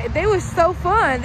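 real delight at fond memories; gushing. Real, not acted. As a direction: savor each word with joy. Gushing about driving lessons.

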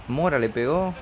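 ordinary talk

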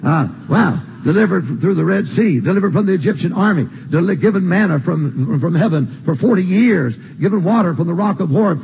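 ordinary talk

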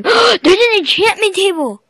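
high, loud gasping exclamation; excited discovery. Real, not acted. Gamer spots an enchantment table, excited.

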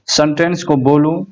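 normal talking voice

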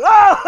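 sudden loud high cry of pain; pained yelp. Acted. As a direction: yelp loudly and high as it hurts. Head stuck, it hurts. (kêu đau thét lên to và cao)

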